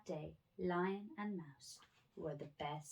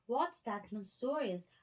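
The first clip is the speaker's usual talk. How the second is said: higher, louder rhino voice; a big rhino, outraged; 5 semitones higher, 5 dB louder than usual. A narrator's reading. Rhino voiced, first take.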